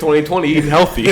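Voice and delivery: mock the unhealthy snack; sarcastic laugh. Real, not acted.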